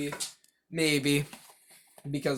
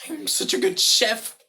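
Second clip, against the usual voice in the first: bright, higher TV-chef voice; cooking show host; 9 semitones higher, 4 dB louder than usual. Pretend. Mocking cooking show hosts, she does a higher TV chef voice. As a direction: play the smug TV chef. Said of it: "you should say in your cooking show".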